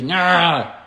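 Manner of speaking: loud, high frazzled yelp; jittery outburst. Acted. Trainer imitating owners who get frustrated and panicky, jittery outburst. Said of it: "you see jittery".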